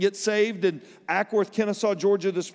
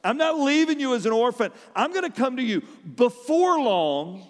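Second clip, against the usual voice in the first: fervent preaching; 7 semitones higher than usual. Acted. Preaching Jesus' promise with fervor.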